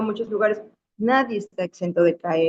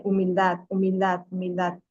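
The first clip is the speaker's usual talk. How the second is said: humildad said three times, fading softer each time; repeated key word; 4 dB quieter than usual. Shown as a demonstration. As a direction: let humildad sink in each time quieter. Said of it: "es clave". (humildad, cada vez más suave)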